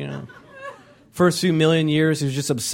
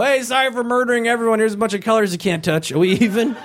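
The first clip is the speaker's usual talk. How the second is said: flippant sorry; 6 semitones higher than usual. Retold or imitated. God apologizing for flood.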